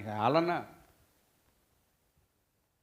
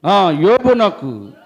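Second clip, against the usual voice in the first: loud, high fervent sermon voice; full-force preaching; 9 semitones higher, 13 dB louder, slower than usual. Acted. Preacher retelling Job's friends to congregation, fervent. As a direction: preach it out with fire.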